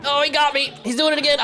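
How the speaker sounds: high-pitched quick exclaiming; excited surprise. Real, not acted. Player reacting as a friend grabs him, high excited surprise.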